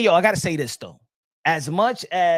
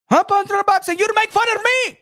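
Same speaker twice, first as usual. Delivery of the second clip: put-on high, louder accented challenge; the Turk impression; 12 semitones higher, 5 dB louder than usual. The Turk confronting Punch Drunk Boxing, high and indignant. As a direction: demand it like an offended boss. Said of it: "You understand the Turk?".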